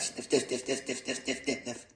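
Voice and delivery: long run of rapid, soft sniffs; sniffing noises. A narrator's reading. Moose smelling another carrot, rapid soft sniffing. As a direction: keep sniffing the words in quick rhythm.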